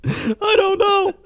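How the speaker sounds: high-pitched squeak through laughter; squeaky high. Real, not acted. Cannot stop laughing.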